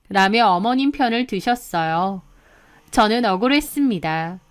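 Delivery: ordinary delivery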